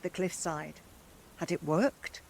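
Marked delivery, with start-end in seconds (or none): none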